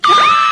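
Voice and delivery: very high squealing fan scream; fangirl scream. Retold or imitated. Imitating a girl's shriek as she meets [name]. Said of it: "You're like".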